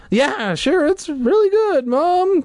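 put-on teen overselling praise to her mom; quick eager reassurance. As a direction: cover the hurt fast and bright. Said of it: "Carly Beth answered quickly".

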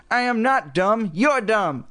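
squeaky ventriloquist's dummy; high squeaky puppet retort. A narrator's reading. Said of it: "dummy say in a high, squeaky voice".

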